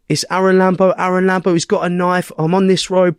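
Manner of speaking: high-pitched mimicked police report; insistent caller. Mimicking the attacker repeating himself to police, insistent caller. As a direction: rattle off the caller's claims up high.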